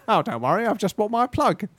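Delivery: high-pitched mock superhero voice; Russ. Podcaster imitating his friend as plug superhero, high-pitched impression. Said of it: "It's just like him".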